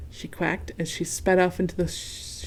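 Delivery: ordinary delivery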